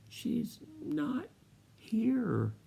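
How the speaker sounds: high, soft little whimper; a small, lost child holding back tears; whimpering boy. Retold or imitated. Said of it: "I kinda whimpered a little bit".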